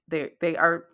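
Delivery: ordinary talk, nothing marked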